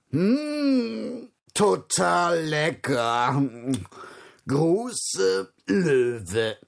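slow hippo voice, smacking over the food. Acted. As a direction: savour the algae like a hippo. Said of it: "und das Nilpferd las".

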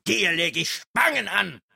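high, loud threat; wütender Schrei. A narrator's reading. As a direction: scream the threat furiously. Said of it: "schrie Örök". (hoch und wütend schreien)

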